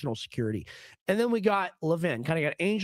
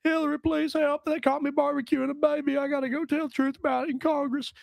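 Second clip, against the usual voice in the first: higher, throaty voice with rolled vowels; Bill Clinton impression; 10 semitones higher than usual. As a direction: whine for Hillary like a cornered Clinton. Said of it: "I do better Bill Clinton than he does".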